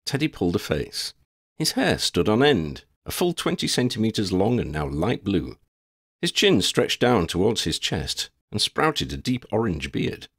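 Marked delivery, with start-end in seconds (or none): none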